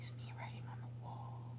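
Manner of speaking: breathy unvoiced whisper of the line; whispered repeat. Real, not acted. Reader rereading the message to viewers, whispered. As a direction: hush it like a secret omen.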